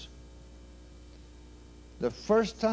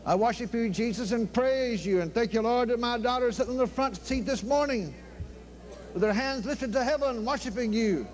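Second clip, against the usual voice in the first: high, fervent praise prayer; 8 semitones higher than usual. Real, not acted. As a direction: lift it up in worship. Opening praise prayer, raised pitch.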